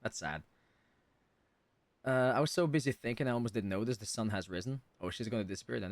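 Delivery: ordinary talk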